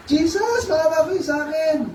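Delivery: high wailing plea for mercy; acted desperate cry. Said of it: "but he cried the mo".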